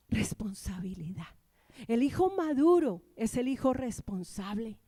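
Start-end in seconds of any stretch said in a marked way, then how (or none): 0.1-1.3 hushed emphasis. Real, not acted. Repite la palabra clave en susurro.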